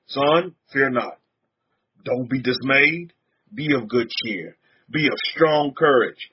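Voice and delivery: slow, low last words to a son; lightly voiced deathbed charge, spaced out; dying father's counsel. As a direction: hand down parting advice slowly. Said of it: "as I take my last breath".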